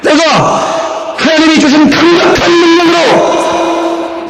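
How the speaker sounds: high, drawn-out declamatory shout; slow, high-pitched heroic shout; rousing battle cry. Acted. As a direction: cry it out like a triumphant claim. Caleb claiming the land, acted.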